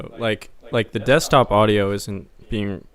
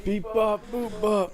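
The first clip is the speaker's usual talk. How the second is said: high robot voice; 11 semitones higher than usual. Pretend. Copying robot noises.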